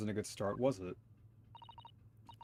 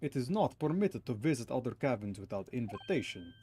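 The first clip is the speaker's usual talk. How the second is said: Russian sailor voice. Acted.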